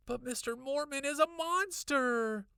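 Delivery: high, loud protest; crying out. A narrator's reading. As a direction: plead it at full volume. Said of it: "I cried".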